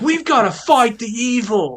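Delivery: slow, high California surfer drawl; surfer dude voice. Retold or imitated. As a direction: play a laid-back cartoon surfer hero. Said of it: "They're all like".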